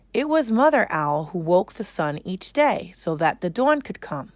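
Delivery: ordinary delivery, nothing marked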